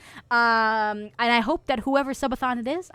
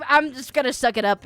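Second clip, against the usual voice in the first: normal talking voice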